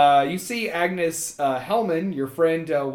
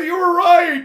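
nervous ghost; 10 semitones higher, 7 dB louder than usual. Acted. Ghost voice in scene.

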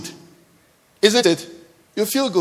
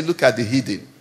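mock disdain; 8 semitones lower, 6 dB quieter than usual. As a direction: lower and softer, a sniffy looking-down. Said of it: "you say".

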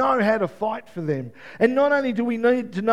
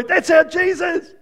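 excited preaching; 6 semitones higher, 8 dB louder than usual. Real, not acted. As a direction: shout the joy out.

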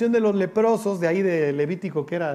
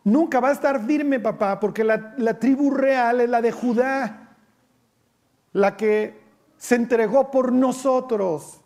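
higher, slow earnest son; acted Jonathan; 5 semitones higher, slower than usual. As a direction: plead it slowly to your father, higher. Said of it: "Jonatán diciendo". (Jonatán respondiendo a su padre con fervor)

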